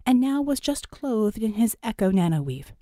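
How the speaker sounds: ordinary delivery, nothing marked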